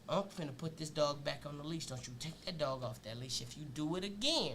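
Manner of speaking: a steady firm talking-to, not aggressive; calm low dad talk. Retold or imitated. Dad calmly warning his son about the leash, low and steady. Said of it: "He said".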